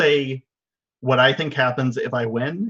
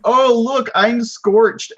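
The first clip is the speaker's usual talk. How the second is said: higher, louder mock cry; mock dismay; 9 semitones higher, 5 dB louder, slower than usual. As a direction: play at being scorched, high and loud. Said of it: "it's just like".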